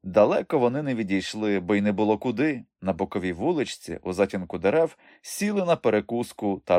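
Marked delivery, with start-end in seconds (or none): none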